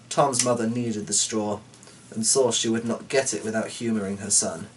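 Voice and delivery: ordinary delivery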